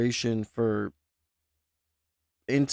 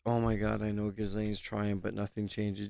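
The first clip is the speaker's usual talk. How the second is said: softer, almost under the breath, in shock; stunned hush; 4 semitones lower, 8 dB quieter than usual. Real, not acted. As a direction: repeat it quietly as if it hit hard. Shocked, reading the diary line.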